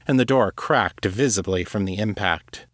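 plain reading voice

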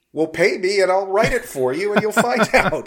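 laughing through a cheeky quote. Retold or imitated. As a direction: chuckle while delivering the line. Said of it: "I guess he said".

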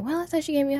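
a light imitation of a bewildered friend; confused friend. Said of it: "Janice looking like".

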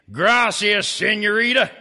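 put-on hammy Spanish accent, loud; mock Spanish charm; bad Spanish accent. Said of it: "speaking with a bad Spanish accent".

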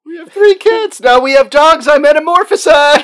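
comic desperate outburst; mock hysterical protest. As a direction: shriek it like a soap-opera spouse. Jilted lover in prank scene.

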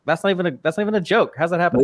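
quick, high incredulous outburst; acted disbelief. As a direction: rattle it off, baffled. Mimics watcher's disbelief.

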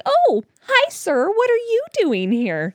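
high chirpy mock-innocent hello; high surprised oblivious hi; bright surprised greeting. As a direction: greet him with no clue who he is. Clueless greeting to a celebrity.

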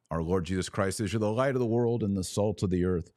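ordinary talk

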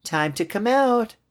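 put-on high, slow child's singsong; drawn-out high little-kid call; little girl. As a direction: coax a toy in a small child's voice. Girl talking to her toy.

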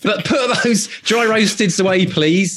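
fast exasperated shout from set; mock director scolding.

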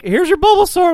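high, loud imitation of someone handing it over; mock chirpy handover. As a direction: mimic them in a high, loud voice. Retelling how he was handed it.

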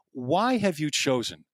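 ordinary delivery

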